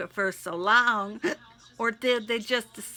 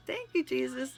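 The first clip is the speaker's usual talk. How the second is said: laughing; 4 semitones higher, 7 dB quieter, faster than usual. Real, not acted. Laughing at joke.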